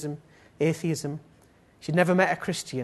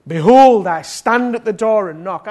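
loud, raised proclamation; ringing full-force declaration; proclaimed scripture; 6 semitones higher, 15 dB louder than usual. Acted. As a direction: boom the verse out like a preacher. Preacher proclaims a scripture verse.

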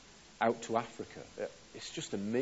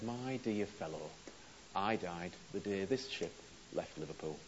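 calm quiet reply; 3 semitones lower, 7 dB quieter than usual. Acted. Missionary calmly answers captain.

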